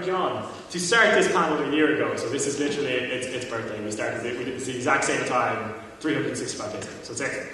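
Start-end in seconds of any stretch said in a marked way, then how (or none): none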